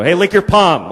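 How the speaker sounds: abrupt, tactless demand; demonstrating the careless way to ask; blunt demand. Shown as a demonstration. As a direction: blurt it out as a bald order. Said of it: "You can't just go".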